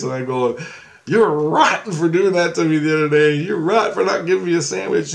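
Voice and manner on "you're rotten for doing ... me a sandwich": higher-pitched mock telling-off; raised-pitch playful rant; retold scolding yell. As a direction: scold as if half-joking. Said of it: "yelling at Grace".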